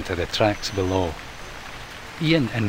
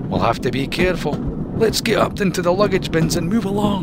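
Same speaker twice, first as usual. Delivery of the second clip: quick, higher urgent raccoon; urging; 8 semitones higher, 3 dB louder, faster than usual. Acted. Mac urging Ian, quick and higher. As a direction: push him to keep moving. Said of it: "Mac urged".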